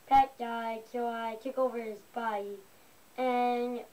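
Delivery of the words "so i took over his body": usual speaking voice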